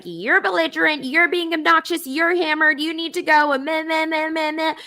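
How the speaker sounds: high-pitched mocking nag, run on into meh-meh; mock screaming lady. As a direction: mimic a scolding woman in a high, monotone whine. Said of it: "starts screaming at me".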